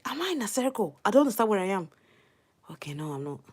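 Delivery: ordinary talk, nothing marked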